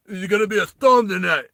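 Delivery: botched movie-star impression, pushed loud; Sylvester Stallone attempt. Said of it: "Wait, that's not Sylvester Stallone".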